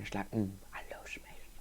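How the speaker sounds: fast breathy whisper. Pretend. Gamer whispering his love of smashing. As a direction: whisper it like a secret craving.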